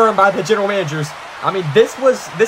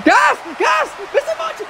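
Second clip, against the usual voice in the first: very high frantic yelling; panicked yelling. Acted. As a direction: yell in panic at the chaos. Panicked commentator as Munch rampages.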